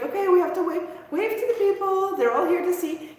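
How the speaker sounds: a hint of a regal queen greeting crowds; mock royal wave-to-the-people voice; queen skit. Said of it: "goes into her queen skit".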